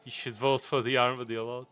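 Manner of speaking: usual speaking voice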